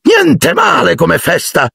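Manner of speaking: high, slow self-satisfied remark; pleased host, savouring it; proud. A narrator's reading. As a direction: boast a little about your party. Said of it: "disse lui orgoglioso".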